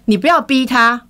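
mock loud rebuke; retold loud scolding. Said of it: "很大声的说".

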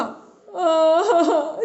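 high, staged wailing; acted uncontrollable crying; bursting into sobs. A narrator's reading. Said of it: "也忍不住哭起来了".

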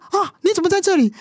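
put-on a mother suddenly spotting her child in the wrong place; surprised. Mother spotting daughter in the seats, startled and high.

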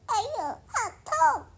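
high, laughing hammed-up cry of pain; mock ouch. The bunny is hit by the baboon, a giggly mock ouch. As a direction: squeal it and giggle through.

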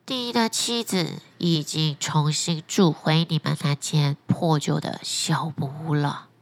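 a low, unhurried talking fish; flounder voice. Acted. Flounder final verdict.

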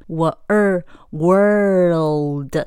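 world drawn out very slowly, curling the r; curled American r. Shown as a demonstration. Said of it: "美式它的R的音要卷舌".

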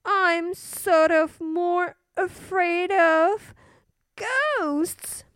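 put-on high, childlike little princess; a small girl asking to keep her light on. A narrator's reading. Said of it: "said the little princess".

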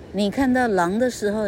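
plain reading voice

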